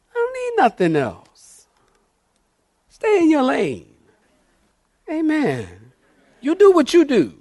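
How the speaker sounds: high preacher's cry falling at the end; worked-up, high-voiced declaration; high-pitched insistence. Real, not acted. Preacher keeps up high protest.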